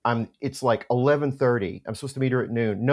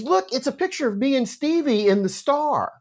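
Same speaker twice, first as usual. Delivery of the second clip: much higher, proud and chirpy granny; old lady voice; 12 semitones higher than usual. Retold or imitated. The owner proudly shows a tabloid photo, high and chirpy. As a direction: show off the photo like a delighted grandma.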